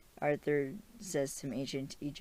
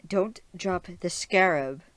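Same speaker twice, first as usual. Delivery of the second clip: loud, raised command; voice in his head; 4 semitones higher, 9 dB louder than usual. Acted. The voice warning Stephen during the chase, loud mock command. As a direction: order it firmly, pitched up. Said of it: "the voice is like".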